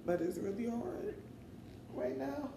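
real tears pushing through the words; voice breaking. Real, not acted. Grieving, trying to keep talking.

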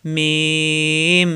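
মিম held very long into a hum; lips closing again. Shown as a demonstration. Teacher demonstrating for learners to copy, drawn-out articulation. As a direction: release the lips and rejoin them on মিম. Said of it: "আবার মিলাতে হবে".

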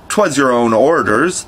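ordinary delivery

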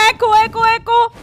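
loud, high repeated name; excited calling. Real, not acted. Player calls to the newly found Echo, excited calling.

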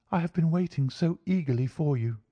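high-pitched, eager lady client; relieved young woman, pitched well up; eager cry. A narrator's reading. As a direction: greet them eagerly in a high feminine voice. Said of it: "she cried".

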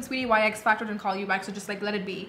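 ordinary talk

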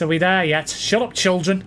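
ordinary talk, nothing marked

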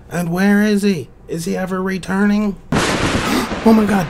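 high, alarmed disbelief with a gasp; astonished. Acted. Host reacting to a contestant who never returned, astonished. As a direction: build from worried questions to a loud gasp.

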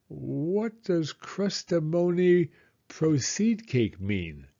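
slow, puzzled mispronunciation; puzzled Pooh. Acted. Pooh puzzling over Owl's big word, slowly. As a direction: stumble through the long word.

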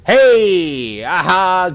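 loud, high, stretched cheer; gleeful holler; triumphant shout. Real, not acted. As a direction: crow in victory. Grabs key, celebrates.

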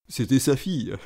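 ordinary talk

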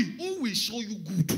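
slow, loud bold proclamation; bold challenge. Retold or imitated. Preacher quoting those in David's time, bold challenge. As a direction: declare it boldly, drawing it out. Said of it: "who said boldly".

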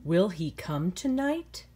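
slow, gentle storybook reading; reading to children. A narrator's reading. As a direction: read it like a bedtime story to kids. Teacher reads picture book aloud.

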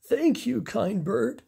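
the orangutan thanking Bernard; grateful thanks. Acted. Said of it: "he said".

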